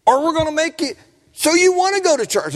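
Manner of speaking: high-pitched mock parental threat; imitated parent laying down the law; insistent parent. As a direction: push it high and firm, imitating a fed-up parent. Voicing a parent forcing church attendance.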